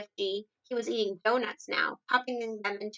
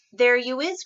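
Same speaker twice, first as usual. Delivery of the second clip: loud, eager cry from the giant; the BFG pouncing on proof; cried out; 3 semitones higher, 10 dB louder than usual. A narrator's reading. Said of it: "cried the B F G".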